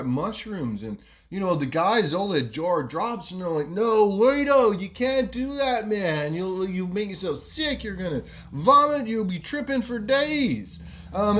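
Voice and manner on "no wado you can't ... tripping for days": higher, urgent mock protest; alarmed warning. Friends to show-off mate after he eats mushroom, alarmed protest. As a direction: plead with a reckless mate, pitched up.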